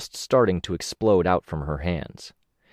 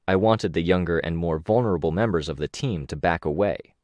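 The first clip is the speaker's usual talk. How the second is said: plain reading voice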